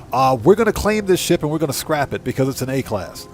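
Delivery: ordinary talk, nothing marked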